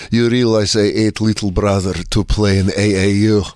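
put-on low, menacing Eastern Bloc accent; Eastern European accent. Eastern Bloc player answering a heckler, thick accent. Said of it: "from a war-torn Eastern Bloc country".

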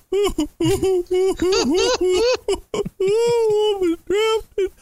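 high exaggerated mock sobbing; mock crying. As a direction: wail like a big baby crier. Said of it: "The mock cry is about how he's crying".

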